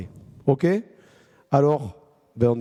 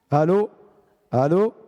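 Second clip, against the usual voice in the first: raised, rising phone call; loud phone hello; 3 semitones higher, 5 dB louder than usual. Pretend. Pretend phone call, calling loudly.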